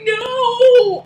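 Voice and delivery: high, loud, half-laughing cartoon wail; Tom's anguished cry. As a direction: wail it like the cat losing everything.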